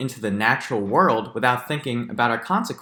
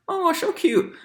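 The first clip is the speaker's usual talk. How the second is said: much higher, sweet coo on Oh, so cute; cooing over cuteness. Shown as a demonstration. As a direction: coo at the pangolin like a baby. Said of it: "Look at the pangolin".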